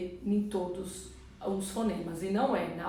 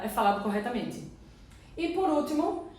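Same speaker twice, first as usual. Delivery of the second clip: normal talking voice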